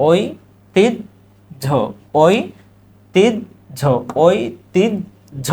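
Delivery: ঐতিহ্য drawn out very slowly as oi-ti-jjho; slow model pronunciation. Shown as a demonstration. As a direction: spell out ঐতিহ্য slowly, doubling the j. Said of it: "কি হবে?".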